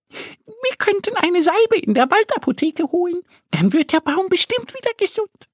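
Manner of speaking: high little duck voice, sure of herself; Annie suggesting. Acted. Annie the duck suggests the forest pharmacy, high and confident. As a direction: pitch it high and lean on bestimmt. Annie proposes her idea.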